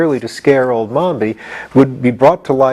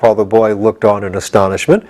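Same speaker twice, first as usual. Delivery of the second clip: usual speaking voice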